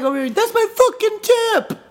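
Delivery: hammed-up outrage, half laughing; mock outraged bartender. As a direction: squeal it like a bartender whose tip just got eaten. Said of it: "there's nothing like".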